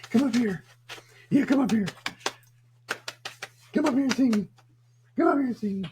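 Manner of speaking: high-pitched coaxing pet voice; doggy talk. Real, not acted. Owner coaxing the dog to come up here, high-pitched doggy talk.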